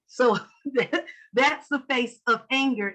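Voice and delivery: normal talking voice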